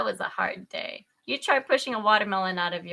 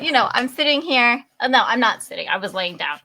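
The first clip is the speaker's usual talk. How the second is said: usual speaking voice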